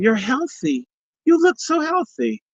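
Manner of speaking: high, mock-cheerful doctors' voice; mimicking doctors. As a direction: ape the doctors' chirpy reassurance. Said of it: "as the doctors kept saying".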